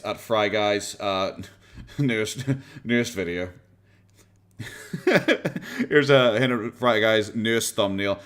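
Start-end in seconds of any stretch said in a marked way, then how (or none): 4.6-5.8 high-pitched burst of laughter; cracking up; real laugh. Real, not acted. Commentator amused by Fry Guy's newest video, laughing.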